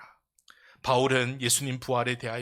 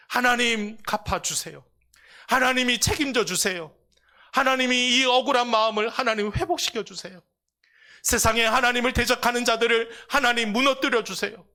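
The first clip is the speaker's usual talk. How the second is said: high, loud desperate plea; pleading cry to God; 10 semitones higher, 6 dB louder than usual. Acted. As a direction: cry out to God for justice, voice raised. Crying out prayer to God. (하나님께 울부짖는 높고 큰 간절한 기도)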